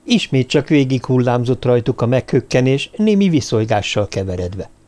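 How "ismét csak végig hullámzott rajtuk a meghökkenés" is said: ordinary delivery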